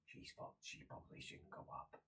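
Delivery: very soft, almost voiceless; whispered aside. Acted. Game master voicing guard judging the visitor, hushed whisper. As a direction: whisper it behind her back.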